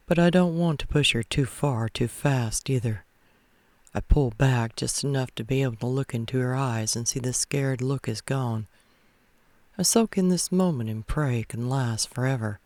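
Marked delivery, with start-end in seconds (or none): none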